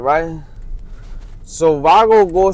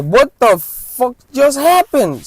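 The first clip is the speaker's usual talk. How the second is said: higher, slow, stunned outburst; shocked disbelief; 8 semitones higher, slower than usual. Retold or imitated. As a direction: blurt it out stunned by the gunshot. Shocked at sudden shooting.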